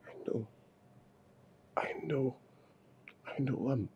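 soft, breathy admission; husband whispers back in remorse; remorseful whisper. Acted. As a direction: whisper it in shame.

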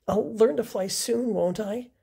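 a touch of worried hope; hopeful Bernard. Acted. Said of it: "said Bernard".

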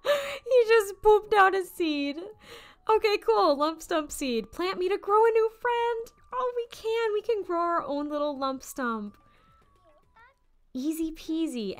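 high, loud little creature voice; cute creature voice. Acted. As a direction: play the tiny plant buddy, squeaky.

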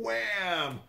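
sharp high "wham" for the hit; mock impact "wham". Retelling the tree hit, a sudden high "wham". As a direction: blurt the crash of a head on a branch. Third hit on same spot.

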